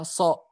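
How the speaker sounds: "so" with a thin s like sin; pangkal lidah turun. Shown as a demonstration. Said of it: "tidak naik pangkal lidahnya". ("so" tipis, pangkal lidah tidak naik)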